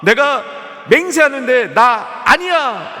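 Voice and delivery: ordinary talk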